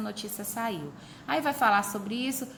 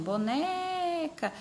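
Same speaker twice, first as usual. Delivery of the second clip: "Boneca" called out high with a very long "ne"; high, sustained middle syllable on "Boneca"; calling the name; 8 semitones higher, much slower than usual. Shown as a demonstration. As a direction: call "Boneca" as if summoning her, holding the "ne". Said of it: "você demora mais naquela sílaba".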